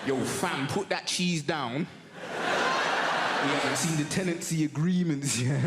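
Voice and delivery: deep, low mock mouse voice; low street-wise mouse voice. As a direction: play a mouse gang leader with a low voice. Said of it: "It's like".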